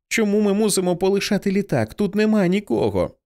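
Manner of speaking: put-on high-pitched child's questioning; lightly voiced girl. A narrator's reading. Blind girl questions leaving the plane, high child's voice. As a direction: lift the voice high, a touch of protest.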